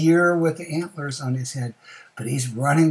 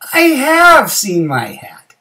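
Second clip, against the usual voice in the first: loud, high, drawn-out exclamation; sudden realization; 11 semitones higher, 12 dB louder, slower than usual. Acted. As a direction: cry out the discovery, stretching it. Bear suddenly remembers the hat.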